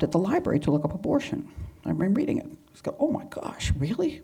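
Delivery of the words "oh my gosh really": high, hushed gasp of disbelief; whispered astonishment; shocked whisper. Retold or imitated. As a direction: breathe it out, stunned. Reacting to reading about abortion.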